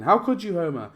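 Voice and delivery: high, loud hurt cry at Homer; heartbroken plea. Retold or imitated. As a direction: plead like a betrayed friend.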